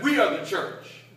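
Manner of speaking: loud, high call back; one congregant stands and shouts the answer loudly; congregant's shout. Retold or imitated. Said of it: "stood up and said".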